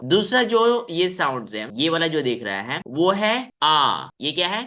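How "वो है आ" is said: आ held very long and slow; vowel demo. Shown as a demonstration. Teacher introducing the second vowel, long slow आ. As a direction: open wide and draw out आ. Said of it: "करो सब कोई".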